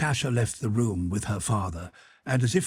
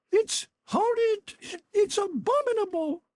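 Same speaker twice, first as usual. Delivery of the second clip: indignant scream. Acted. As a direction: cry out in outrage. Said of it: "she screamed".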